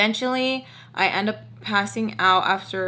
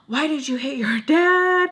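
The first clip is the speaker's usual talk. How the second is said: mock shocked reproach; 6 semitones higher, 4 dB louder than usual. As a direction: exclaim it, imitating horror. Retold shocked scolding after gasp.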